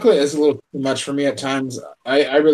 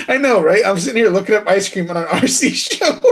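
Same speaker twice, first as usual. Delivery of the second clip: laughing while talking; 8 semitones higher, 4 dB louder than usual. Real, not acted. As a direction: giggle through the words. Laughing at own joke.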